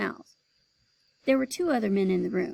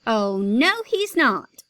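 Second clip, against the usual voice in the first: calling out; 8 dB louder, much slower than usual. Acted. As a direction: cut in loudly. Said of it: "another mother called".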